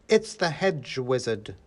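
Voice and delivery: slow, deliberate announcement; stately young woman denouncing an intruder; scornful young lady. A narrator's reading. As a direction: announce him slowly, with disdain. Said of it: "she said".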